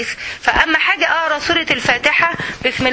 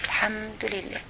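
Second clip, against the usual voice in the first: barely voiced, softer aside; soft murmur; 4 semitones lower, 14 dB quieter than usual. Real, not acted. Teacher murmuring praise quietly to herself. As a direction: let it fall away almost under the breath.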